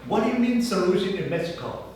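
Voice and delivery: normal talking voice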